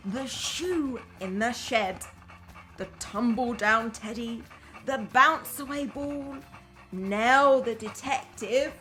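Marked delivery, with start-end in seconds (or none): none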